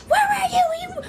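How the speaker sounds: high, loud mock screech; frantic scolding. Relative confronts returning runaway child, frantic scolding. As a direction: imitate an alarmed relative demanding answers.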